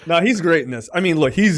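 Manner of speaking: usual speaking voice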